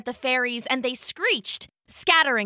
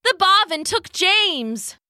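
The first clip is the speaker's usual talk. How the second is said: alarmed cry; 7 semitones higher, slower than usual. Acted.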